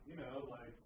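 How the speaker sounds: a hint of a slow-talking homeless man; imitating homeless guy. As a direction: drop the voice and drag it out like a drifter. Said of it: "And he's like".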